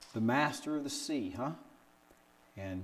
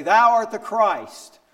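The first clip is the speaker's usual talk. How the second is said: loud, high, slow declaration; bold proclamation; 9 semitones higher, 14 dB louder, slower than usual. Retold or imitated. Peter standing up at Supper, declaring it loudly. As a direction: ring it out like a confession of faith. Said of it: "And he'd say".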